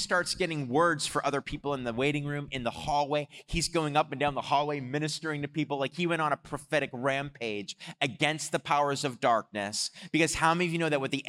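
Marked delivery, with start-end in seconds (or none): none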